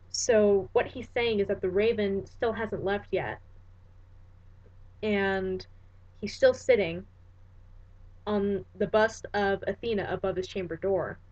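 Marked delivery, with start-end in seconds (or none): none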